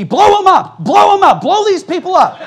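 high, loud mock yell; imitated yelling. Son yelling at the TV during a movie, imitated shouting. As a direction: bellow it like a man hollering at the screen. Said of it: "he starts yelling at the T V".

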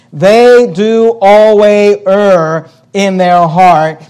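each word slowed and leaned on; slow emphasis. Acted. Preacher to congregation, slow heavy emphasis on the verse. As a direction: lay weight on every word of the verdict. Preacher stresses God's verdict.

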